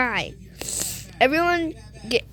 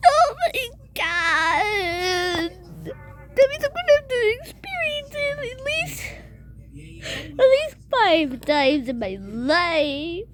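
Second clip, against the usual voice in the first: high, whiny crying complaint; play-acted tearful dismay; 5 semitones higher than usual. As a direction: wail it in mock despair. Scared figure crying over tornadoes.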